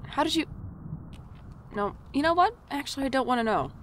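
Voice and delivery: high, loud, rattled; alarmed. Acted. Clerk unnerved that she knows his sister, rushed. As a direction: backpedal fast, unnerved.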